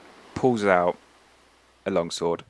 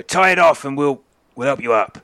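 raised, loud deckhand shout; calling down; 6 semitones higher, 6 dB louder than usual. Acted. Crew to the people in the boat below, calling down loudly. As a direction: call it down over the side.

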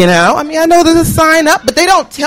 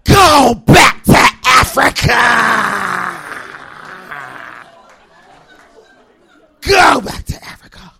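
long bellow with a stretched last word; sudden yell; 3 semitones lower, much slower than usual. Retold or imitated. Man yelling at students as they leave, sudden bellow. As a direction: holler it across a building, drawn out. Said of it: "he suddenly yells".

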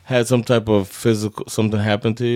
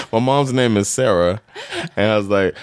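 ordinary talk

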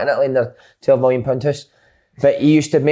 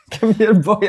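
higher-pitched mock cowboy call; cowboy accent; 4 semitones higher, much slower than usual. Teasing a mate with his catchphrase in cowboy accent. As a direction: put on a playful cowboy accent. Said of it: "and cowboy accent".

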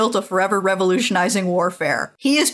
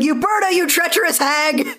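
shrill mock outrage; 8 semitones higher than usual. In the recap, an outraged choir leader accuses Uberta with a hammed-up shriek. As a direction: shriek the accusation like a scandalized lady. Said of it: "It's like".

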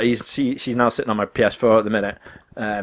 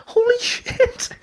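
stunned; much slower than usual. Retold or imitated. Reacting to the bad news.

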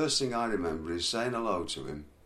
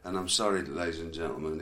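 ordinary talk, nothing marked

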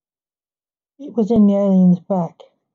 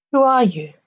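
higher-pitched, falling demand; 4 semitones higher than usual. A narrator's reading. Captive alien to his captor, demanding. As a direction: mildly insist on an answer, pitch starting high. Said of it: "demanded the alien".

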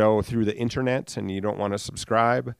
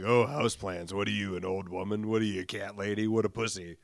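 lightly voiced scoffing tough guy; mocking manly man; 3 semitones lower, 5 dB quieter than usual. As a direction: sneer like a macho guy. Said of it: "I can just hear all you, like, manly men".

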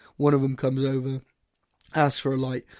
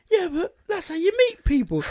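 high-pitched protesting imitation; high, insistent quoted retort; mock protest. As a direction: imitate a friend arguing back, pitched high. Retells drunk friend's protest.

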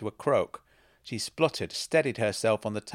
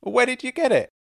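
high, loud, astonished question; excited demand; 9 dB louder, faster than usual. A narrator's reading. Woman asking where he got the coat, excitedly. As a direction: demand it, voice pitched right up. Her blurted outburst about coat continues.

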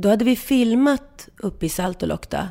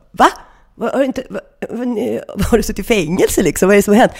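rising, incredulous questions that climb higher; shocked disbelief; 4 semitones higher, 6 dB louder than usual. Retold or imitated. To the old cabin host at dinner, shocked disbelief. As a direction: act stunned by his answer.

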